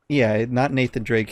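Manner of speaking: ordinary delivery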